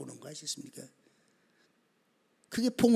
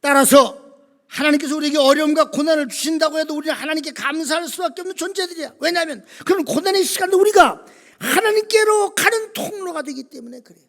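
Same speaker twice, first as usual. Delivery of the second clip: loud, high sermon delivery; full-force preaching; 8 semitones higher, 13 dB louder than usual. Real, not acted. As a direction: drive the point home to the congregation, loud and raised.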